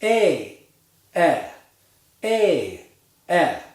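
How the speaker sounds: "A" tense and gliding, "E" lax and short; contrasting A and E. Shown as a demonstration. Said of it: "Let's try to pa-, the pair together".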